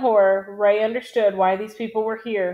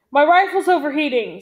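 raised, higher-pitched cry of alarm; narrator's moderately raised cry; 5 semitones higher, 4 dB louder than usual. A narrator's reading. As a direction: cry it out to your squad, pitch lifted then falling. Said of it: "Zimmer cried".

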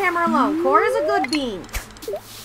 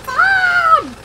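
high, loud shriek for mom; yelling for mom; 6 dB louder than usual. Acted. Panicked streamer shrieking for mom after an explosion. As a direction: scream out for your mother in panic.

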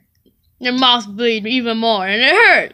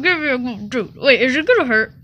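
usual speaking voice

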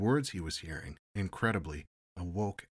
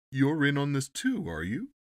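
taunting; 8 semitones higher, 9 dB louder than usual. Acted. Ambassador taunting the captain.

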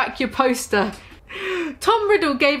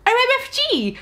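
high squealing O M F G; excited squeal; 12 semitones higher than usual. Acted. Girl squealing to her friend, excited. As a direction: squeal it out in shock.

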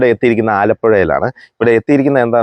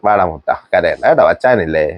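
comforting; 7 semitones lower than usual. Real, not acted. Vlogger soothes the crying boy in the house, low and tender.

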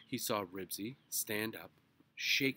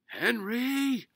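high, very loud drawn name; stern shout; 17 dB louder than usual. A narrator's reading. Mister Huggins calling his son in the chase, shout. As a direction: shout the name like a summoning father. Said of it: "shouted Mister Huggins".

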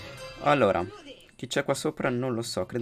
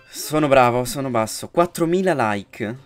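hyped challenge; 3 semitones higher, 9 dB louder than usual. Real, not acted. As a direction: pump up the audience. Sets the likes goal. (sfida entusiasta)

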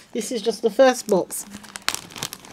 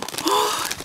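high, soft gasp of delight; overwhelmed gasp; 11 semitones higher, 6 dB quieter than usual. Real, not acted. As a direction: gasp at too many treasures. Overwhelmed by box contents.

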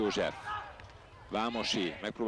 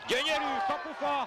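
high, thrilled cry of a near goal; excited call; 12 semitones higher than usual. Real, not acted. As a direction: leap up in pitch on the post. Shot hits the post.

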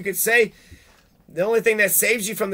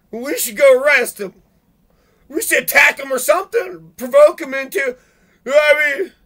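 high, loud, incredulous put-on voice; mocking cops; 6 semitones higher, 7 dB louder than usual. Ranter mocks cops eager to arrest a man reading. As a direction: sneer as a cop outraged at a peaceful reader.

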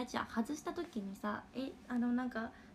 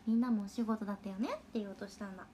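ordinary delivery

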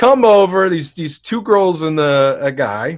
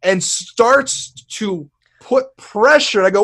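ordinary talk

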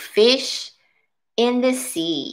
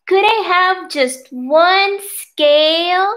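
high, slow small fish voice; little fish pleading; 8 semitones higher, 4 dB louder, slower than usual. Acted. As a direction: beg sweetly, up high. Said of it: "he said".

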